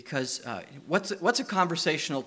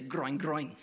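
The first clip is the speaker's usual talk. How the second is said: groin, groin grunted through the nose; piggy groin, groin with a laugh; Romanian pig grunt. Shown as a demonstration. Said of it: "Their pigs say".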